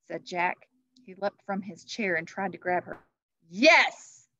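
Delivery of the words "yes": high, loud triumphant boom; thundering. Acted. Christmas Knight accepting Annie's offer, thundering. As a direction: thunder the agreement. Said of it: "thundered the Christmas Knight".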